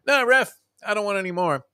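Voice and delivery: high, strained-up plea to the ref; wrestler giving up. Retold or imitated. Wrestler in a submission hold, pleading to quit. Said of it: "in a submission hold".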